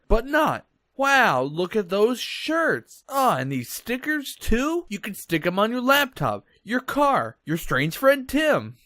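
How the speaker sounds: overeager infomercial host; cheesy ad voice. Pretend. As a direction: sell it like a cheap commercial. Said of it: "enjoyed that just beautiful ad".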